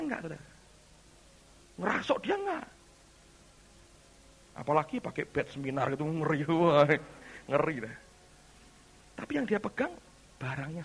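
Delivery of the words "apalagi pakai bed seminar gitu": normal talking voice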